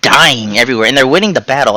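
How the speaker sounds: ordinary delivery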